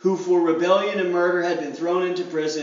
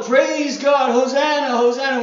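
higher, louder hosanna cheer; mock excited praise; 4 semitones higher, 5 dB louder than usual. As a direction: hail him like the crowd. Crowd acclaiming, herd mentality.

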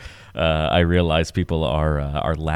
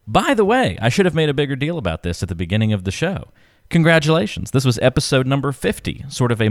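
excited; 8 semitones higher than usual. Real, not acted. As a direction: burst out with the news. Announces milestone excitedly.

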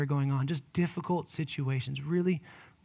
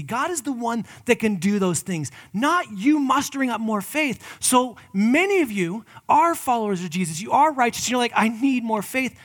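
louder, higher driving sermon; preaching build; 6 semitones higher, 7 dB louder than usual. Acted. Pastor urging followers of Jesus, building loud. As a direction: build the force line by line.

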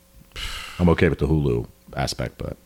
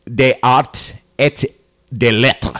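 careful, slow French phrase; slow, high, deliberate French accent; French pronunciation; 10 semitones higher, 6 dB louder, much slower than usual. Pretend. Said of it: "I would attempt to speak French".

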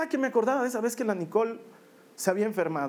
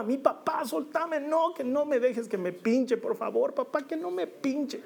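put-on higher, slow, desperate child's cry without real volume; screaming little girl; 6 semitones higher, slower than usual. Girl struggling in her father's arms begging him, mock desperate cry. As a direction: beg to be let go like a terrified child. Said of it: "me gritaba".

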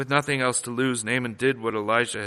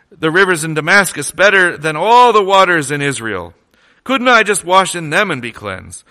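loud, indignant scripture reading; angry Naaman; 4 semitones higher, 8 dB louder than usual. A narrator's reading. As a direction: read it as a furious general storming off. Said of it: "He went away angry and said".

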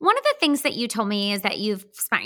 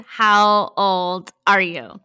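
dramatic signature question; 2 semitones lower, 6 dB louder, much slower than usual. Shown as a demonstration. Her standard podcast question, mock-dramatic.

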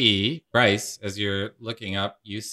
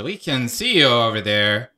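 louder, higher ghostly call; 5 semitones higher, 7 dB louder than usual. Acted. Ghost spotting hidden companions, calling out louder. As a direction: spot the hidden ones and call them out. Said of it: "and says".

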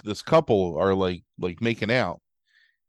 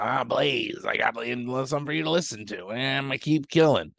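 creepy killer voice; 2 semitones higher than usual. Retold or imitated. Killer taunting on phone.

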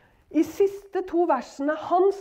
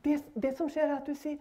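normal talking voice

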